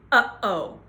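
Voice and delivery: uh-oh with a hard glottal catch before each vowel; uh oh throat stop drill. Shown as a demonstration. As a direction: close the throat sharply on uh-oh. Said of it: "To do a glottal stop, say the word".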